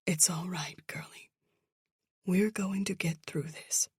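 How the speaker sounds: hushed, tender reassurance; soft whisper. Acted. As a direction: whisper it like comforting a child. Mother comforting daughter on couch.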